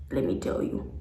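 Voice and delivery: low, soft voiceless warning; whispered warning. Retold or imitated. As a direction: breathe the warning. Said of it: "the police officer is just like".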